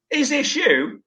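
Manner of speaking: high-pitched amused disbelief; mock incredulous. Colleague finds his modelling photo, imitated with high incredulity. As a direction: imitate someone gleefully catching you out.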